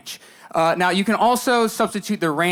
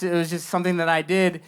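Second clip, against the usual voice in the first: normal talking voice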